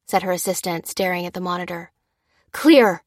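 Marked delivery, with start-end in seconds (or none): 2.5-3.0 loud warning. A narrator's reading. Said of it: "repeated the medic".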